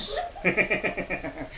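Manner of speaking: soft real laugh; soft chuckle. Real, not acted. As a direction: laugh softly at the punchline. Preacher laughing at own joke.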